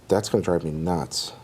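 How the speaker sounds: normal talking voice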